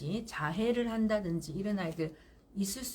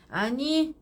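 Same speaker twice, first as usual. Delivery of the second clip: a sharp louder no; firm no; 4 semitones higher, 6 dB louder, much slower than usual. Shown as a demonstration. Mother stopping a crying child, a firm loud no. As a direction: cut it off firmly. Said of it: "단호해야 돼요".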